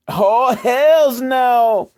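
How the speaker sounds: high, loud, drawn-out sassy exclamation; hammed-up diva retort; sassy mock answer. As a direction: throw it up high and stretch it out, full sass. Sassy mock answer to own question.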